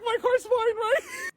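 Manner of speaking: very high, tiny anxious question; mock nervous squeak. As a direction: ask it in a small, squeaky fake-innocent voice. Nervously checking on her car.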